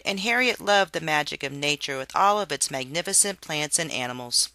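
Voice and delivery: ordinary delivery, nothing marked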